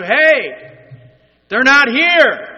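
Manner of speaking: high, loud call across a distance; acted far-off yell. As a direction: holler it out like a caretaker, drawn out. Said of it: "he yells out to you".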